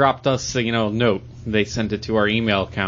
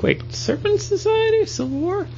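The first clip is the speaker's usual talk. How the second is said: surprised. Retold or imitated. Retelling his confused reaction.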